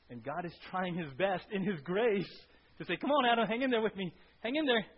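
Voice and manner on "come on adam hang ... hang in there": loud, high, pleading encouragement; God coaxing Adam. Acted. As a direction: urge him gently to stay.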